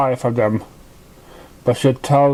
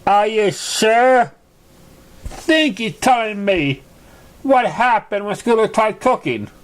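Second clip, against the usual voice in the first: doubtful colt voice; 6 semitones higher, slower than usual. Acted. Mango asks, doubtful.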